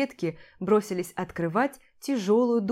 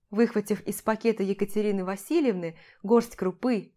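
usual speaking voice

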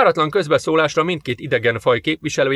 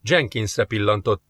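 ordinary delivery, nothing marked

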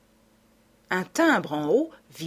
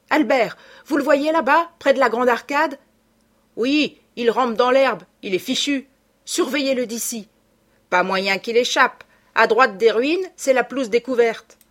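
calling out; 5 semitones higher, 6 dB louder than usual. A narrator's reading. Shouted exchange about the fleeing man.

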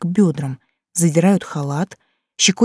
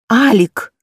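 calling the name out louder; 4 semitones higher, 4 dB louder than usual. Acted. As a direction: raise the voice on the name. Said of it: "повторяю громче". (громче, настойчиво)

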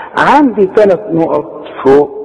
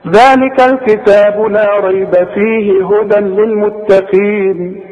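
slow chant joining ريب, فيه and هدى; no pause at all; 4 semitones higher, slower than usual. Shown as a demonstration. Teacher demonstrates third way of reading, unbroken through ريب and فيه. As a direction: recite the verse through ريب and فيه in one breath. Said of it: "عندي يبقى ثلاث طرق لقراءة هذه الآية".

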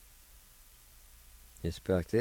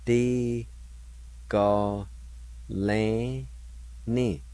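syllable by syllable; 2 semitones higher, much slower than usual. Shown as a demonstration. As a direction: Di ga lan ni, each syllable said alone with pauses. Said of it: "It's pronounced like this".

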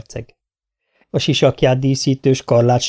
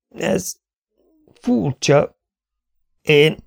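broken, faltering higher voice; halting tongue; 5 semitones higher, much slower than usual. A narrator's reading. Dying prince struggles to speak, halting and dazed. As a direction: falter between single words, with long gaps. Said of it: "felelte Baylor akadozó nyelver".

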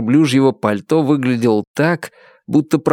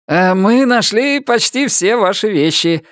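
high-pitched, hesitant official; eager-to-placate agent, pitched up; obliging agent; 9 semitones higher than usual. A narrator's reading. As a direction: announce it in a high character voice. Agent arrives with the lost things.

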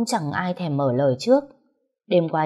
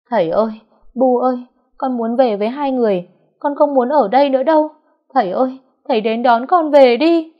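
loud, much higher crying wail for her parents; 8 semitones higher, 8 dB louder than usual. A narrator's reading. Liên crying for her parents after nearly drowning, wailing. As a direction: bawl like a homesick child. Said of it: "Liên bỗng khóc um lên".

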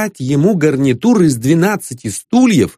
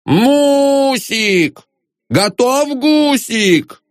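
high, drawn-out plaintive yowl; hungry husband's high-pitched wail; wailing cry; 12 semitones higher, much slower than usual. A narrator's reading. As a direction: wail it high, unable to hold back. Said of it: "завопил".

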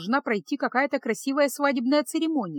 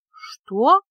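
stunned, louder gasp of a question; опешив; 3 semitones higher, 8 dB louder than usual. Acted. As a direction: caught completely off guard. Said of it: "опешила Феска". (ошеломлённо, громко)

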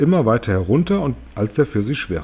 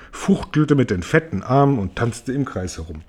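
ordinary delivery

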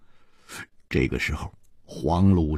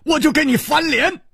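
high-pitched frantic urging; desperate, high-pitched threat; urgent dismissal. A narrator's reading. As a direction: drive them away, voice strained high. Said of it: "一点红只是挥着手说".

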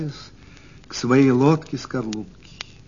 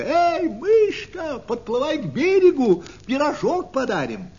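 high, long-drawn hail; high-pitched storybook shout; calling from shore; 11 semitones higher than usual. A narrator's reading. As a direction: stretch out the call as children shouting over water. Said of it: "кричат ей ребята с берега".

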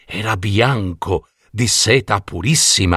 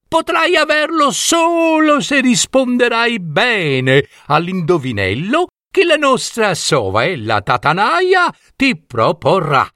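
high storybook fox mother; 12 semitones higher than usual. A narrator's reading. Mother fox instructing Jelina, high and gentle. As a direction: explain the riddle like a kindly fox. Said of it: "disse mamma volpe".

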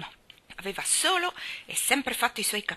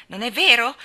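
loud, higher disbelieving question; challenging; 5 semitones higher, 11 dB louder than usual. A narrator's reading. Colin challenges Mary's disbelief, defiant. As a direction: throw it back as a dare. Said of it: "la sfidò".

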